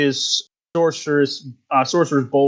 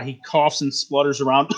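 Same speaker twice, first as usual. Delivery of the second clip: ordinary talk, nothing marked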